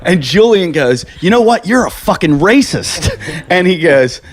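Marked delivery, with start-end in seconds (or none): 1.2-3.1 higher, mock-outraged telling-off; indignant retort. MC confronting a prank-call victim over the loudspeaker, imitated indignation. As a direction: imitate an offended MC calling someone out. Retold MC confronting caller on phone.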